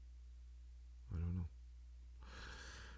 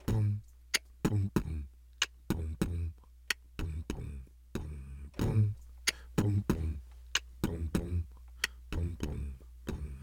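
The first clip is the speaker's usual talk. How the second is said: vocal beat; 4 semitones lower, 4 dB quieter, slower than usual. Pretend. Vocal beat for theme song.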